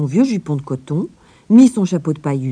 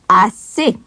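higher, louder curt command; sharp cutoff; 6 semitones higher, 6 dB louder than usual. A narrator's reading. Rottenmeier cutting Heidi off, sharp and louder. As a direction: snap her short. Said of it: "coupa mademoiselle Rottenmeier".

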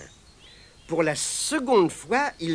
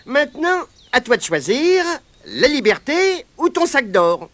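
put-on higher, louder boyish voice; boy's demand; 9 semitones higher, 7 dB louder than usual. Pat demands freedom or gold, higher and louder. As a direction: press the captured leprechaun.

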